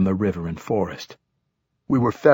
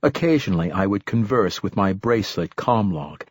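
ordinary delivery

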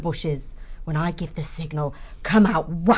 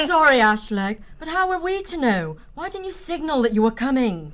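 higher-pitched, protesting apology; apologetic, pitched up; defensive apology; 8 semitones higher than usual. Acted. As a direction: plead your case to an angry comrade. Vixen defends her mistake.

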